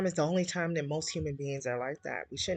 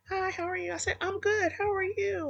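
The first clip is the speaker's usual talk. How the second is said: high, chirpy re-enacted hello; sing-song pleasantries, pitched way up; retold friendly greeting. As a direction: act out both sides of a friendly greeting, much higher. Acting out a friendly greeting exchange.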